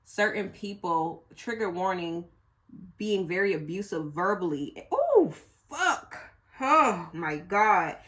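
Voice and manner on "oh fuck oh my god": breaking into a high cry, then a slow, choked "oh my God"; real crying. Real, not acted. Reader overcome by a painful message, crying out. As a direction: voice cracking with real tears. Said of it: "now I'm getting emotional".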